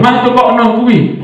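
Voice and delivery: lightly voiced child pleading for a toy; a hint of a whiny kid asking mum; child begging. Child at the mall asking mama to buy a toy, lightly whiny.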